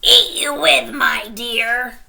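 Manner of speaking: loud, high snarl; wolf roaring the punchline. A narrator's reading. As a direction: snarl and lunge. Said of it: "snarled the wolf".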